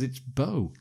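ordinary talk, nothing marked

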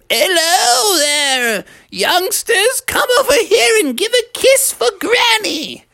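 high, drawn-out granny voice; doting cartoon granny; Grandmother Bear. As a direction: greet the grandkids in a high old-lady voice. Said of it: "Grandmother Bear".

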